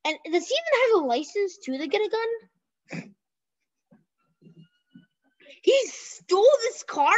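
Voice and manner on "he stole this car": higher, slow disbelief; can't believe what he hears; stunned, drawn-out question; shocked question. Real, not acted. Learning the suspect stole car.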